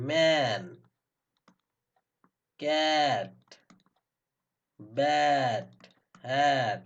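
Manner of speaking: "ম্যান, ক্যাট, ব্যাট, হ্যাট" with long, loud ae; drawn-out "ae" vowel. Shown as a demonstration. Teacher drilling A as ae, each vowel held long. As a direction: stretch the ae in each word, one at a time. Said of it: "আবার এ দেয়".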